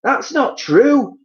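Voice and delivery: terrified wife denying it; Missus Twit crying out. Acted. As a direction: cry out in disbelief as the wife. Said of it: "cried Missus Twit".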